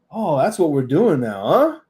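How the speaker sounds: slow needling quote with a jumping final huh; Roman Reigns. Retold or imitated. Fan imitating wrestler's favorite catchphrase, pointed high tag. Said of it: "he'll say a sentence and then end with the".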